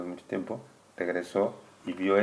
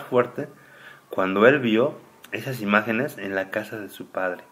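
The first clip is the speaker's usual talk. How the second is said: reading scripture aloud, louder and slower, solemn storyteller; lectura solemne; 2 semitones higher, 8 dB louder, slower than usual. Acted. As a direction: read it like sacred text to a child. Reads scripture aloud to child. (lectura solemne en voz alta, más fuerte y pausada)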